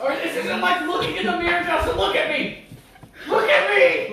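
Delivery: high-pitched, hammed-up calling out; high, laughing mock demand; mock exasperation. Calls out during mock demonstration.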